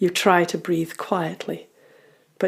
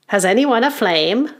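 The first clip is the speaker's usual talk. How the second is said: raised, loud goblin uncle voice; older goblin; 5 semitones higher, 5 dB louder than usual. Acted. Goblin uncle asking the children for a flame, older goblin. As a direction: ask the group like an elder goblin. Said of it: "He sounds older than the rest".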